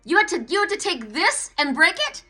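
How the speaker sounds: furious complaint to Spirit; angry accusation. Retold or imitated. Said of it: "And I said to Spirit".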